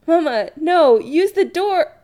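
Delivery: high, loud plea to stop; alarmed protest. Acted. Son to his mother about to vanish, alarmed protest. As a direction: call out in alarm, pitch shooting up.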